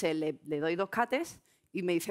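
ordinary talk

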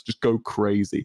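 usual speaking voice

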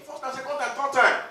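ordinary talk, nothing marked

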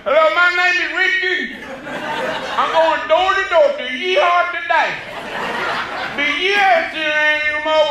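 high, hammed-up old-man voice going door to door; squeaky salesman parody of the audience member, played big and loud; high comic voice of Richard selling himself as an operations man; mock Richard.

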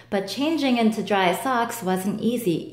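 ordinary delivery, nothing marked

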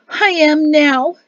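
high, loud and drawn out; joyful relief. A narrator's reading. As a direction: let relief swell slowly through the words. Overjoyed mother greets her son.

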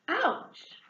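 high yelp of pain; sharp ouch. Acted. Hedgehog hit by an apple, high yelp of pain.

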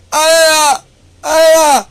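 high, loud, hammed-up crying shrieks; over-the-top fake sobbing squeals; mock frightened wailing. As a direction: wail like a scared crybaby to mock them. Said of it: "Eso deben gritar".

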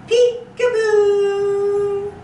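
high, loud, slow drawn-out peekaboo; excited peekaboo. Pretend. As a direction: stretch the reveal for the little ones. Bunny found, peekaboo reveal.

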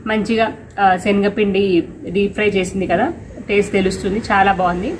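speaking between bites; talking while chewing. Real, not acted. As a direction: mouth partly full of snack. Seen 0: Eats a snack before, chews, then holds snack and bowl while speaking.

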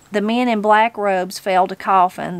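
ordinary delivery, nothing marked

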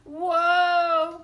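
high, loud drawn-out "whoa"; loud acted groan. A narrator's reading. Tortoise and Bird on the wobbling branch, groaning loudly. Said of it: "groaned Tortoise and Bird".

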